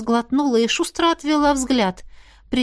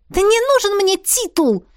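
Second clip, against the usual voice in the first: high, rushed outburst; blurted in one breath; 11 semitones higher, 4 dB louder than usual. A narrator's reading. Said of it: "выпалила на одном дыхании". (выпалить на одном дыхании)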